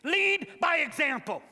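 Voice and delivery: loud, high preacher's call; full-force sermon delivery; fiery preaching. Acted. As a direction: preach it to the back row.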